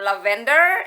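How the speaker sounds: questioning "lavender", stressed on VEN and rising; "lavender" with a rising end, middle syllable stressed; wrong stress. Retold or imitated. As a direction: offer la-VEN-der tentatively. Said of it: "So I was like".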